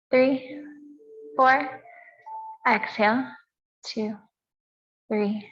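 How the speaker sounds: calm, measured yoga teacher's voice with long pauses; guided meditation. Real, not acted. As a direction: count the breaths evenly, leaving space between phrases. Leads breathing and meditation.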